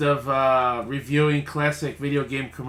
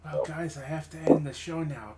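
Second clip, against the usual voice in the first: ordinary talk, nothing marked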